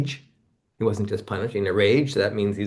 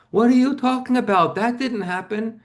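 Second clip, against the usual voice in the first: high, mock-indignant mother voice, clearly pitched up; mother's denial; 12 semitones higher, 4 dB louder than usual. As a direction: play his mother brushing it off, pitched up. Mother denies the abuse.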